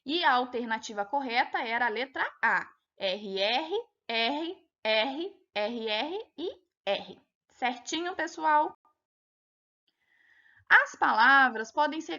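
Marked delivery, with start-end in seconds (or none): none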